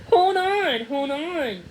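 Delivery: laughing through the words. A narrator's reading. Boy laughing as the puppy licks his face. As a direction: giggle while the puppy licks. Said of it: "Charles laughed as Buddy licked his face".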